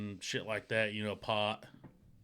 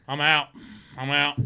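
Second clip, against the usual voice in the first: panicked quitting; 6 dB louder than usual. Retold or imitated. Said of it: "He's like".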